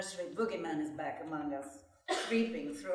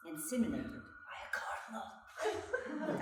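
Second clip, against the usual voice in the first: giggling whisper; 3 semitones higher, 7 dB quieter than usual. Acted. Narrator giggles at the joke.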